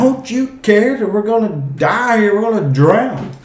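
high, frantic cry of drowning men; panicked plea. Retold or imitated. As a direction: plead in terror over the storm. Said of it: "that's what they said".

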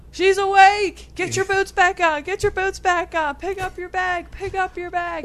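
high, loud drill sergeant barks; yelling drill sergeants. Retold or imitated. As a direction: bark the orders like drill sergeants. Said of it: "yelling at me".

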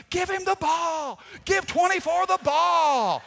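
high, loud yell from the stands; yelling mom. Retold or imitated. Mom yelling from the stands at the coach, loud and high. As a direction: shout it to the coach like a proud mom. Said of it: "she'd be yelling all game".

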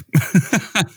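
a short bright laugh, high up; light laugh. Real, not acted. Co-host laughs after calling the sun scary, a short bright laugh.

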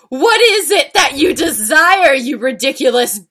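high, loud theatrical questioning; dramatic demand. Acted. Reader voicing an angry teacher's question, high melodramatic demand. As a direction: demand it grandly with a swooping pitch. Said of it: "Come on, we're doing voices".

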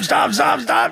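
"stop, stop" shouted very high in hammed-up panic; mock shout. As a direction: scream "stop" as a tiny squashed ant. Mock pleading from ants.

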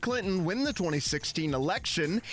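ordinary talk, nothing marked

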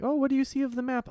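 high, fast sing-song quizzing; mock nanny. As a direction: play the pushy nanny grilling the kid. Imitating Italian nanny quizzing child.

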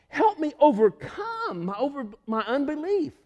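acted desperate appeal; crying out. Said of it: "He's crying out".

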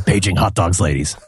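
low terse deadpan read; grim clipped vigilante diary; Rorschach voice. Retold or imitated. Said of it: "reading these as Rorschach".

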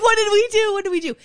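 mock panic. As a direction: fret like you've been caught out.

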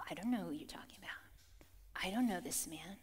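low, whispered disavowal; hushed denial. Acted. Peter denying Jesus to strangers in fear, hushed. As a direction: drop to a furtive whisper.

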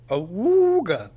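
high, loud cartoon horn honk; slapstick awooga. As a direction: honk it like a clown horn for the baby. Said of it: "So I do a lot of slapstick".

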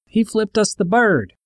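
high, slow mock yell; bully yelling back, high and slow. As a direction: call it back across the yard, drawn out. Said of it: "yelling back".

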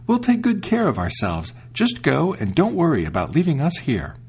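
put-on high, eager children's voice; young goat kids. The kids reassure their mother, high and eager. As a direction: chirp it like little goat kids.

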